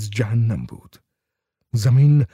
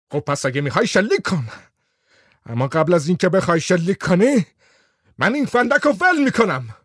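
threatening; 6 semitones higher than usual. Acted. Whitlam threatening with lighter.

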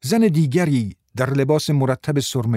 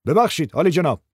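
understated shout to get attention; calling out; 4 semitones higher than usual. Acted. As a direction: call across a noisy courtroom. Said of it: "فریاد زد".

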